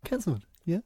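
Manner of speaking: whisper. Real, not acted. Sighing over cancelled show.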